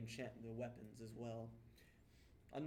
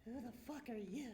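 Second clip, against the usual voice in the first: higher, confused goblin snap; 12 semitones higher than usual. Acted. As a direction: demand it like a lost creature.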